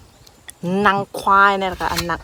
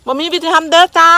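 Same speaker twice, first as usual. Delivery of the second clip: บ่มีวิธีทำเด้อจ้า said higher and louder; raised emphatic repeat of บ่มีวิธีทำเด้อจ้า; 6 semitones higher, 6 dB louder than usual. Real, not acted. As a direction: call it out again, pushing บ่มีวิธีทำเด้อจ้า up and louder. Repeats the line, the second louder.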